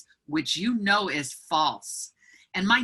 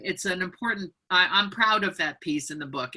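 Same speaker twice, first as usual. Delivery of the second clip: ordinary talk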